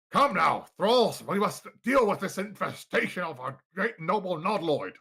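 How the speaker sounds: loud, high, pompous summons; rally your thralls grandly; imperious master. Acted. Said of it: "you hear a deep reverberating voice".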